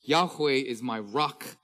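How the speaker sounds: reading psalms out loud with full force, drawn out; fervent scripture reading. Acted. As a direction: proclaim each verse to the congregation. Reading psalms aloud, preaching.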